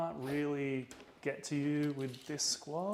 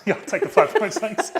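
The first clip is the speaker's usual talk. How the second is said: laughing; 9 semitones higher, 13 dB louder than usual. Real, not acted. Laughs while taking the points.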